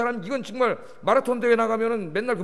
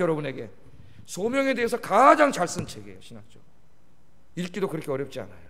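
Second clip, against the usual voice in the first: normal talking voice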